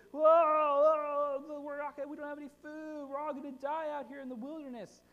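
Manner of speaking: high, loud panicky whine; grumbling Israelites. Retold or imitated. Preacher acting the hungry people grumbling in a high, panicked whine. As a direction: wail it like a scared complainer. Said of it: "They grumble again".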